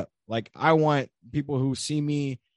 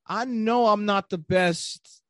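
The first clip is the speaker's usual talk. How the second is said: higher, slow, doubting inner voice; inner self-doubt voice; 9 semitones higher, slower than usual. Retold or imitated. Podcast guest voicing his own self-doubt, high and slow. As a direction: voice the nagging thought slowly, pitched up. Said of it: "I'm like".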